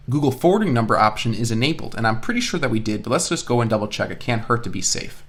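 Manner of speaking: normal talking voice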